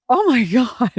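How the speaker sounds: a high, laughing groan of disbelief; laughing exasperation. Retold or imitated. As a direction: let a laugh break through the eye-roll. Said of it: "And I'd be like".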